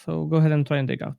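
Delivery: ordinary talk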